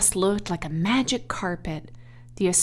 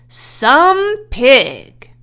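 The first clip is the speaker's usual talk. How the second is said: Some pig, louder and higher, drawn out; reading the web's message; 6 semitones higher, 11 dB louder than usual. A narrator's reading. Narrator reveals the web's message, awed and emphatic. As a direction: read it out with awe, slow. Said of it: "It said".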